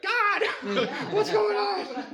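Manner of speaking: comic high-pitched scream with a laugh; hammed-up panicked yell to heaven; crying out to God. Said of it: "Why are you crying out to me?".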